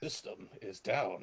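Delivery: low, slow and very soft; low techno-song imitation. Player echoing the cave's techno track, low soft imitation. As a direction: intone it quietly like a background techno vocal.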